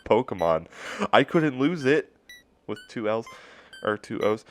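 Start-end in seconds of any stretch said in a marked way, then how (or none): none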